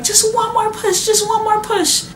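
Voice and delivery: high, loud sing-song coaching voice; mimicking midwife. Midwife coaching the mother to push, high and loud. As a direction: chant it high like an eager midwife.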